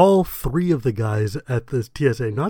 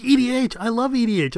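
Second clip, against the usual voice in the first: much higher, gushing with delight; excited fan; 10 semitones higher than usual. Retold or imitated. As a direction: gush like a thrilled fellow player. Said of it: "He's like".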